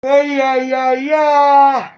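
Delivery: high, loud repeated yeahs; loud cheering. Real, not acted. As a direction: cheer it out over and over.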